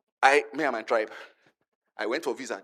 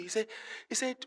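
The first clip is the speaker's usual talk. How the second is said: ordinary delivery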